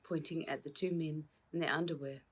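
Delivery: usual speaking voice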